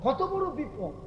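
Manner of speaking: "কত বড় বিপদ" pitched high, falling at the end; high exclamation. Real, not acted. Preacher stressing the danger to listeners, "কত বড় বিপদ" exclaimed high.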